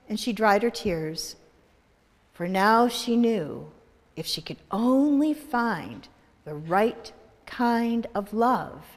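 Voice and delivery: ordinary delivery, nothing marked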